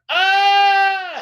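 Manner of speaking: loud, high stoned yelp; stoned exclamation. Retold or imitated. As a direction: blurt it like a stoned teen.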